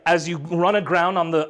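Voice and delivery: normal talking voice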